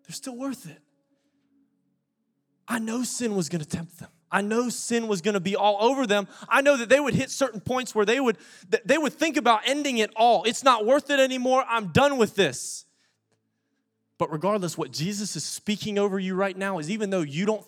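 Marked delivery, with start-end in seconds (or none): 0.1-0.8 higher and soft, a tender repeat; God's gentle echo of the same words. Acted. 2.7-12.8 high, loud pleading preacher voice; preacher voicing God over his people; fervent preaching. Preaching God's voice at full force.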